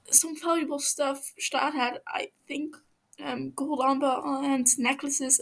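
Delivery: frightened prisoner begging, voice climbing; scared captive pleading. Acted. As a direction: stumble over the words, terrified of him. Captive lists items nervously.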